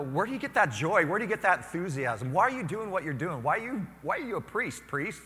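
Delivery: high, loud, probing; eager questions. Retold or imitated. Mother and daughter eagerly quizzing the priest, high and loud. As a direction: press them with curiosity. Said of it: "what they wanted to know".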